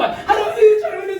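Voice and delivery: high-pitched mock child plea; apologizing grandchild. Preacher imitates grandchild apologizing to grandpa, mock childlike plea. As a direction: pipe up like a pleading grandchild.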